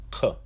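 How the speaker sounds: "K" as "kuh"; Kof sound. Shown as a demonstration. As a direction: sound out "K" with a short schwa. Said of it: "The Kof is pronounced as".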